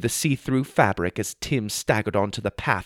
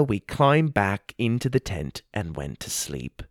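usual speaking voice

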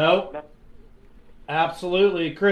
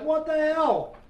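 higher, incredulous what the hell; stunned disbelief; 9 semitones higher, 4 dB quieter than usual. Retold or imitated. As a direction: retell your own shock with a raised pitch. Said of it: "I'm like".

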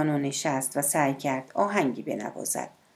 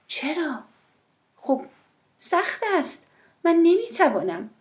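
higher, pleading woman's voice; voiced woman, higher and uneasy; woman, torn; 12 semitones higher than usual. A narrator's reading. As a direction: lift her voice high, hesitant.

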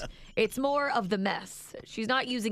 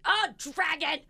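high-pitched imitated outburst; child's startled cry, imitated; loud exclamation; 7 semitones higher, slower than usual. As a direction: blurt it out as if slipping. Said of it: "she said it loud as she fell".

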